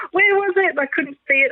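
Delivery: high, eager questions; urgently searching the sky; eager excitement. Retold or imitated. Mum straining to spot the UFO, high and eager.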